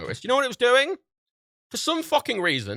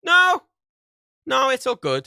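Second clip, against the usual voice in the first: mock cheery reassurance; 11 semitones higher, 6 dB louder, slower than usual. Reassuring the ISP on the phone.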